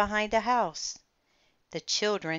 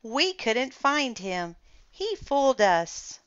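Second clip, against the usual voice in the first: put-on children delighted; 6 semitones higher, 5 dB louder than usual. A narrator's reading. Children praise the dinosaur.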